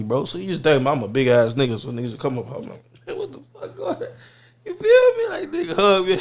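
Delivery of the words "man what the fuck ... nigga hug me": high-pitched, laughing disbelief; cracking up while retelling a startled reaction; laughing startled protest. Retold or imitated. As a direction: act startled, voice shooting up into laughter. Laughing, acting startled at stranger's hug.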